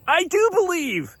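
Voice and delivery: very high, strained outcry; acted-out frantic insistence; desperate cry. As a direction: cry it out as a father pleading. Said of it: "And then he panics".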